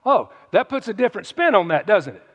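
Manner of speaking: normal talking voice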